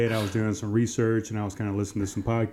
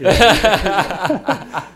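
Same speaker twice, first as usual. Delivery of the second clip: laughing; 9 semitones higher, 10 dB louder than usual. Real, not acted. Laughing at the growler joke.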